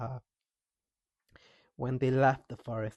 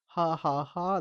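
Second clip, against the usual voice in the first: high, loud mocking "ha, ha, ha"; mocking laughter; 6 semitones higher, 5 dB louder, slower than usual. A narrator's reading. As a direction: laugh at him, higher and louder. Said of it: "they laughed".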